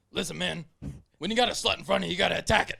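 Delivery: quoted guy. Retold or imitated. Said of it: "he goes".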